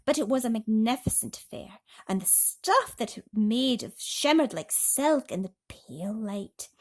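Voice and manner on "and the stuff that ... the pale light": ordinary delivery, nothing marked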